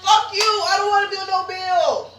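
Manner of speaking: high-pitched mock outburst; exaggerated high-voiced complaint; mock protest. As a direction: squeal a comic refusal to pay. Mock outburst at being billed.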